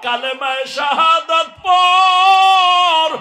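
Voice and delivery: high, loud chanted cry, stretched at the end; retold tearful urging. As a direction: cry out an urgent last appeal in sermon melody. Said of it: "চোখের পানি ছেড়ে কয়". (উঁচু সুরে আকুল আহ্বান)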